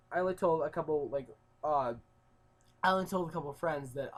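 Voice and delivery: ordinary talk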